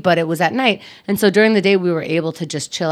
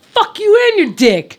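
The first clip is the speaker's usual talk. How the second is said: high, loud New York telling-off; New York accent; 12 semitones higher, 5 dB louder than usual. Pretend. Telling off her brother's belittler, loud New York accent. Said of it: "how much my New York accent comes out".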